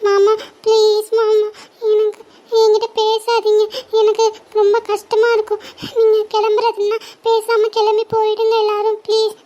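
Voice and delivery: real tearful plea to uncle not to leave; tearful pleading. Real, not acted. Child begs relatives not to leave.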